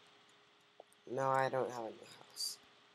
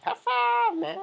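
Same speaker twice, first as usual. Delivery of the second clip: very high cartoon squeak, louder; squeaky high five; 8 dB louder, slower than usual. Greeting a game buddy with a squeaky high five. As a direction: chirp it in a tiny goofy voice.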